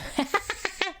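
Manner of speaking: soft high laugh; breathy giggle of relief; relieved laugh. Real, not acted. Streamer laughing with relief over her phone screen.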